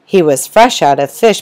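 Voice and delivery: ordinary delivery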